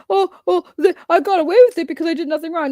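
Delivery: high, fast mocking parody of their innocence claim; mocking quote. Ranting about people who get away with it, in a fast high mocking parody. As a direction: rattle off their excuse like a smug liar. Mimicking their self-defense.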